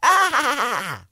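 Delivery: attempted SpongeBob laugh. Shown as a demonstration. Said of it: "Let me try".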